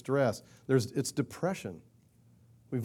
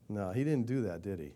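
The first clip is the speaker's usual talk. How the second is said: ordinary delivery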